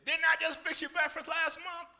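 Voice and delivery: very loud, high, drawn-out indignant shout; hammed-up outraged wife, slow and piercingly high; harsh spousal answer. Acted wife answering husband's request for breakfast, indignant shout. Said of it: "answer you like that".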